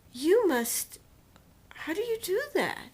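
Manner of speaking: loud, high, mocking disbelief; imitating a contemptuous doctor; incredulous scorn. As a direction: sneer at how anyone could look like that. Voicing the surgeon's cruel question.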